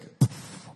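voiceless burst of breath; earthquake sound effect. Shown as a demonstration. Preacher illustrates the earthquake from scripture, breathy sound effect. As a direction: puff out a rumbling blast. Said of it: "Don't have a fire sound effect".

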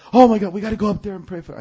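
high, loud, rushed alarm; imitated flustered plea; urgent urging. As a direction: rush it out like a worried wife.

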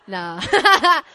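breaking into real laughter mid-line; laughing. Real, not acted. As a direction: laugh it off as you speak. Laughs off a monkey impersonation.

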